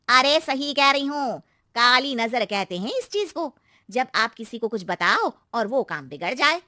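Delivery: warning neighbour aunty. Acted. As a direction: warn her about the evil eye. Narrator voices neighbour aunty. (चेतावनी देती aunty)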